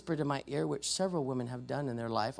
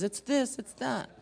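high, raised game-show shout-out; mock yelling out; 9 semitones higher, 3 dB louder than usual. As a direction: call out guesses like a game-show audience. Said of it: "you're yelling out the prices".

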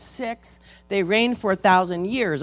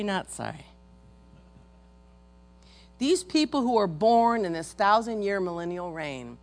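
ordinary delivery

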